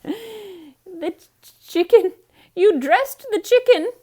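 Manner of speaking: very high, loud, put-on disbelief; aghast. A narrator's reading. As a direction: gasp out the question. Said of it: "asked Missus Rogers".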